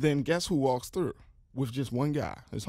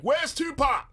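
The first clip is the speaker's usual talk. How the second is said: high-pitched mock holler; retold scream; 10 semitones higher, 3 dB louder, slower than usual. Somebody in the crowd at a star's visit, mock scream. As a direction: holler it out like a heckler. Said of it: "I think somebody screamed out".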